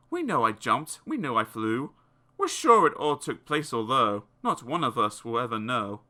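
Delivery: ordinary delivery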